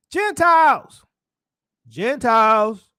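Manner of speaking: loud summons, drawn out the second time; calling out. Acted. Preacher voices heaven to Gentiles, calling out. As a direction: cry out in the voice from heaven.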